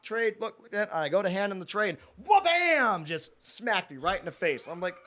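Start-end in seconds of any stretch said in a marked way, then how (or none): 1.9-3.1 mock impact sound. Food tray hits his face.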